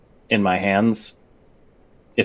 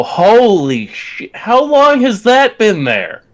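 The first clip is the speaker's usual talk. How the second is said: high, slow rising disbelief; shocked; 11 semitones higher, 4 dB louder, slower than usual. Acted. [name] spotting the gash, stunned. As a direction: gasp it out in amazement. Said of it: "I go".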